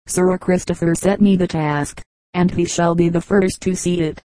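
ordinary delivery, nothing marked

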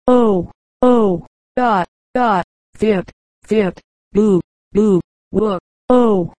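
mock boos and hoots in short doubled cries; lightly acted catcalls; jeering crowd. A narrator's reading. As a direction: imitate a rowdy theatre audience heckling. Imitated jeering of theatre crowd.

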